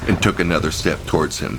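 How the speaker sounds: plain reading voice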